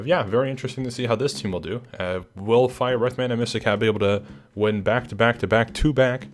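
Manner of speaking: ordinary talk, nothing marked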